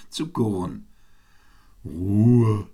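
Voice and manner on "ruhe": loud, drawn-out call for order. A narrator's reading. Owl silences the chattering birds, calling out. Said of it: "rief die Eule".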